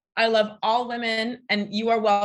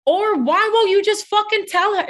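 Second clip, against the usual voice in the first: much higher and louder, fed-up demand; exasperated outburst; 10 semitones higher, 7 dB louder than usual. Real, not acted. Ranting to friends about guys who string women along, exasperated and loud. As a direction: throw the question out in open exasperation.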